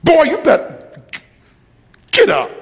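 stern daddy's command; sharp fatherly warning, dropping on the last words; God. Retold or imitated. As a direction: order a son up off the floor. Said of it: "just like a daddy".

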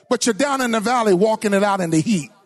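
normal talking voice

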